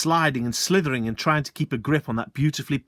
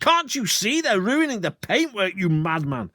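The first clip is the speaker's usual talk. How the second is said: much higher, outraged; furious; 9 semitones higher, 4 dB louder than usual. Acted. Landowner outraged at the sergeant, much higher. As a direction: berate the madman.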